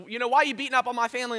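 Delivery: fast, loud, high upset complaint; upset man. Retold or imitated. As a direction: rattle it off aggrieved. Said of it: "He goes".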